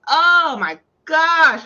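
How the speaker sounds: loud, drawn-out groan of exasperation; real fed-up outburst; exasperated. Real, not acted. Venting hatred of a character.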